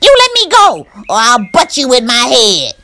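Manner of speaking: very high loud yell; furious rabbit. Acted. Stuck Burr Rabbit screaming at the Tar Baby, furious.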